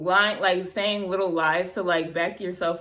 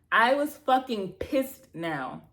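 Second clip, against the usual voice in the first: ordinary delivery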